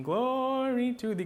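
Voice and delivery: "glory" sung high with the weight on it; stress on glory. Shown as a demonstration. Teacher showing students where the emphasis falls, high sung stress. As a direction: lift and lean on "glory". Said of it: "the emphasis would be on".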